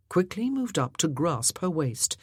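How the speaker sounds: usual speaking voice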